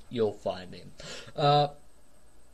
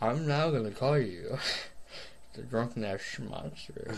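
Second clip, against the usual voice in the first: softer, lower sultry slur through laughs; sexy slurring voice; 4 semitones lower, 7 dB quieter than usual. Acted. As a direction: purr it drunkenly, giggling. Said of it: "my sexy slash slurring voice".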